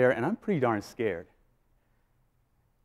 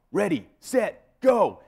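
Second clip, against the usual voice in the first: yelled protocol; 7 semitones higher, 3 dB louder, much slower than usual. Retold or imitated. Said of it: "I yell out the protocol".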